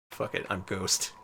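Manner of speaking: ordinary delivery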